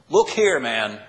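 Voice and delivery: loud, rude Look here, man; disrespectful retort. Acted. Son rudely answering his father, loud and disrespectful. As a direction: bark it at his father with no respect. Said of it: "This is so disrespectful what he says".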